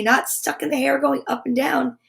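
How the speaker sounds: ordinary delivery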